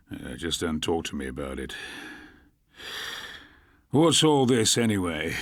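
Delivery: low, gruff and fed up; a weary old copper brushing it off; grumpy detective. A narrator's reading. Grumpy Harry replies.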